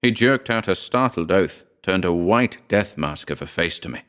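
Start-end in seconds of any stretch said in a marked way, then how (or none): none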